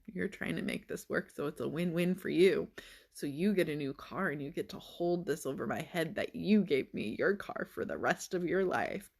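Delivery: ordinary delivery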